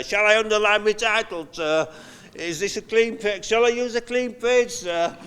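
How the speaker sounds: put-on high, pleading child voice; whiny pupil. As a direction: imitate a fussy schoolchild asking sir. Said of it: "conversations he had with kids".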